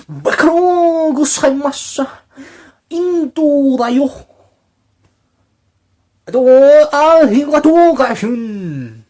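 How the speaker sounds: high, loud, hammed-up mock Japanese accent. Mocking how Kojima talks, with a loud fake Japanese accent. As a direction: shout fake Japanese like a cartoon impression. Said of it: "Kojima talks more like".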